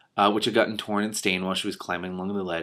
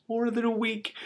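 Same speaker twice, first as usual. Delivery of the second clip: much higher amazed question; incredulous; slower than usual. Real, not acted. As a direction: gasp out the disbelief. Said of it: "incredulous aside".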